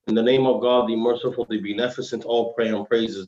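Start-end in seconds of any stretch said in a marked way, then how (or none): none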